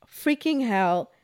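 slow, strained on the verge of crying; holding back real tears, dragging the words; near tears. Real, not acted. Host telling viewers about her dog's rejected trip, close to crying. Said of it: "I'm gonna cry".

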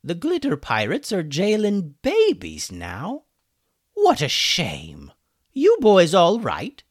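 wry chicken wizard calling over. A narrator's reading. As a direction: call across to the prisoners. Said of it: "another voice called out to them".

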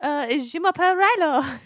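put-on high cute toddler speaking French; lightly voiced little boy introducing himself. Mom imagines her son greeting in French, lightly voiced. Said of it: "so excited for Rilo to be like".

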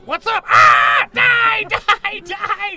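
high shrieking monster scream; screaming spider. Acted. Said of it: "the spider screams".

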